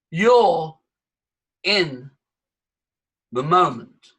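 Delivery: "You're... in... the moment" with long gaps; spaced out, first word punched loud; deliberate pauses. Shown as a demonstration. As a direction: set each word down separately. Teacher spaces words to show presence.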